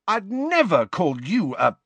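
high, indignant denial; protest, stung. A narrator's reading. James defends himself to Lily, indignant.

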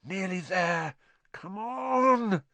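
coaxing, pleading up high; cajoling. Acted. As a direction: coax a stubborn car onward. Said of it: "Ron said cajolingly".

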